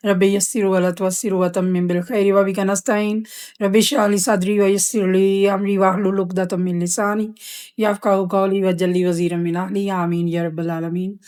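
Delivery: softly recited opening supplication; Arabic prayer recitation. Real, not acted. Teacher opens the lesson with a prayer, softly recited. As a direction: recite it softly in one even, practised flow.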